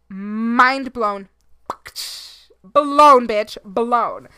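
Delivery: loud hammed-up explosion shout; homemade explosion effect. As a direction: blast it out like your head exploding. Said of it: "gotta give you guys my own, um, sound effects".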